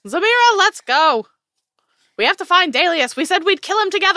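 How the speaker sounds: high, loud and urgent, the last line fast; urgent shout. Acted. Ristos calling Zamira to go kill Dalius, high and loud. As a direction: hurry her along at full voice.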